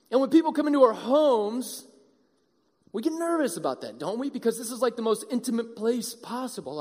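normal talking voice

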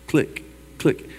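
soft unvoiced click click of the lift chain; whispered clicking. Preacher imitating the roller coaster climbing the hill, whispered clicks. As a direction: breathe the clicks like the coaster ratchet.

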